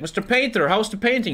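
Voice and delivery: high-pitched wife voice, eager; a high, hopeful widow checking on her painting; wife asking. Retold or imitated. Widow asks the painter about the portrait, high and eager. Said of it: "She's like".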